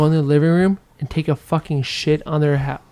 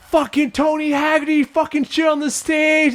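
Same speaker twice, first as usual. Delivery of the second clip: mocking kids; 9 semitones higher than usual. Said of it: "they're like".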